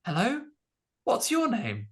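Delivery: put-on high, proper BBC British accent; lightly put-on BBC British voice; a hint of BBC British English; posh BBC British accent. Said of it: "BBC British".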